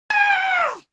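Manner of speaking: loud, high frustrated dummy yell; angry yell. Acted. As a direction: let out an exasperated cry. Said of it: "Why are you getting so angry?".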